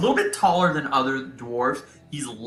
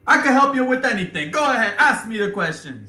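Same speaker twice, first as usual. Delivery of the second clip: put-on higher eager Italian accent; 11 semitones higher, 5 dB louder than usual. Bobo offering help to the deputies, eager Italian accent. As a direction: overeager helper, Italian-style.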